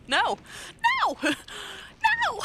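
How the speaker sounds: rising shrieked nos; shouted no. Real, not acted. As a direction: yell it in mock outrage. Outraged at not hydrating.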